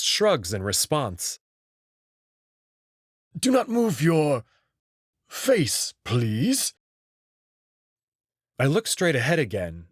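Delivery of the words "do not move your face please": put-on a higher, precise old wolf voice, firmly polite; an old craftsman who wants stillness; higher fussy old wolf, firm but polite. Old wolf makeup artist instructs Marco.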